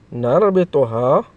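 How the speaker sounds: ordinary delivery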